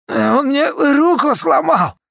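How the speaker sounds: very high, slow howl of pain; pained wail. Acted. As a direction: wail about the broken arm. Durimar wails with broken arm.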